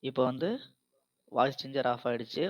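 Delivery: normal talking voice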